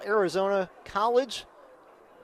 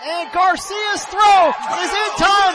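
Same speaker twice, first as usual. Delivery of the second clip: much higher and louder, shouting the play at the plate; shouted walk-off call; 9 semitones higher, 8 dB louder than usual. Real, not acted. Announcer shouting the walk-off winning run to listeners, full excitement. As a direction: explode as the runner slides in under the tag.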